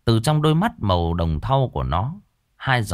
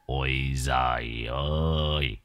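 long, low, drawn-out groan of disbelief; scoffing exclamation; 8 semitones lower, 7 dB quieter, much slower than usual. Acted. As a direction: stretch it out low and soft, dismissively. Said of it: "cười ha hả".

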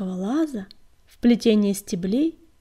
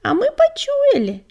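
kitten voice; 9 dB louder than usual. Acted. Kitten answers its father.

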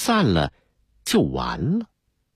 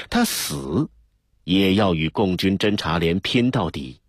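ordinary delivery

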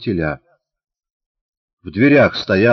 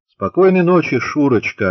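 echoing reply, loud; 7 semitones higher, 4 dB louder than usual. A narrator's reading. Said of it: "отозвался как эхо Антошин".

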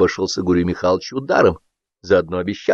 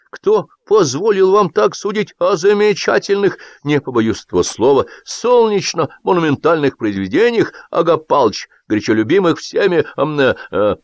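lofty praise faltering into hesitation; pompous indignation; 8 semitones higher than usual. A narrator's reading. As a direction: protest grandly, then stumble for words. Pompous indignant speech, losing words.

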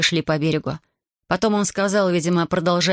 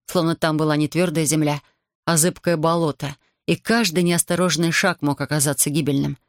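usual speaking voice

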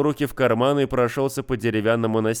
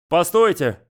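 loud call to stop; 7 semitones higher, 5 dB louder than usual. Acted. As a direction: call after her sharply. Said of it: "окликнул её мужчина".

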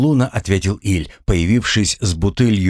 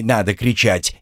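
plain reading voice

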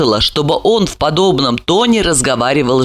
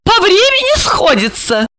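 high-pitched mocking question; high, incredulous derision; contemptuous echo. A narrator's reading. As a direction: parrot it back in a high, scornful voice. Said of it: "выразить всё презрение к человеческой".

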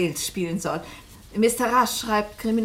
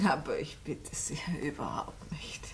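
hushed reassurance; 6 semitones lower, 12 dB quieter than usual. Acted. Polite reassurance to host.

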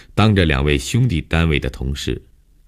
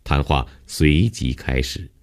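ordinary delivery

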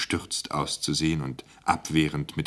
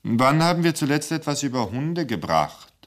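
higher, commanding director's question; imperious boss; 9 semitones higher, 3 dB louder than usual. Acted. Director asks his timid editor, lightly imperious. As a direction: demand it from above. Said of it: "sagte der Intendant".

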